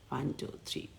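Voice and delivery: hushed whisper of one, two, three; a near-whispered ritual count. Real, not acted.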